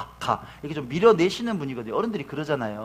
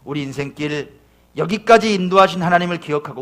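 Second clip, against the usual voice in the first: usual speaking voice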